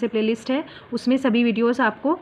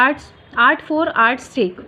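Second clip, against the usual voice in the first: slow dictation; much slower than usual. Shown as a demonstration. Teacher dictates the slogan.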